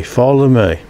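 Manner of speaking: ordinary delivery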